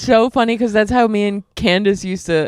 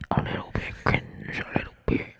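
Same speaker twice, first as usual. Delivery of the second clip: whispering; 11 dB quieter, faster than usual. Acted. Teasing whisper after laugh.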